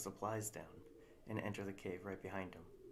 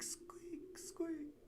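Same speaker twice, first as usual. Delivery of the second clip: squeak echo; 5 dB quieter than usual. Shown as a demonstration. Cave echoing the bat squeak, faint echo. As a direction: squeak whispered back high and far. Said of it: "echoes off the cave walls".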